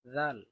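দাল said thin, tongue tip at the front teeth; touch the teeth lightly for দাল; light dal. Shown as a demonstration. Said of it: "এটা একটু পাতলা হবে". (দাল পাতলা করে উচ্চারণ)

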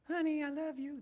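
high-pitched imitation of his wife; Amy. Husband mimics his wife saying she loves him, high sweet impression. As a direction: do your wife's voice, pitched well up. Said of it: "That's how she sounds".

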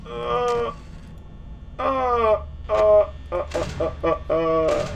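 high, stuttering frightened moans; real scared whining; terrified whimper. Real, not acted. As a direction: whimper in panic, settling into slow dread. Terrified player in horror game.

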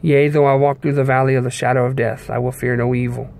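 ordinary delivery

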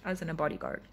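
usual speaking voice

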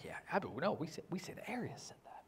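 breathy sneering whisper; whispered aside. Retold or imitated. Arians whisper sneeringly as the creed is read. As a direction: whisper it like a conspirator in the back row. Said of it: "They're going".

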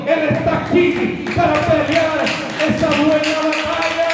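fervent preaching. Acted. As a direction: lift the congregation with conviction. Preaching at full force.

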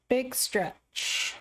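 normal talking voice

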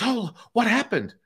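high-pitched mock surprise; imitated bewilderment. Hypnotised man comes round with keys returned, mock startled high voice.

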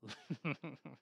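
soft throaty villain laugh; throaty chuckle. A narrator's reading. Grinch laughing at his trick, soft throaty chuckle. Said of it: "The Grinch laughed in his throat".

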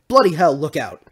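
loud, startled warning; shouted warning. Acted. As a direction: shout it as something comes flying. Warning of a flying missile.

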